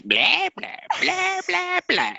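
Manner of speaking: high, drawn-out mocking blah-blah; high silly chatter; mock blabbering. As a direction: parody your own rambling in a high voice. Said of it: "and I was like".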